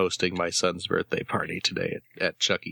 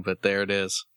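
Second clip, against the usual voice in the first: ordinary talk, nothing marked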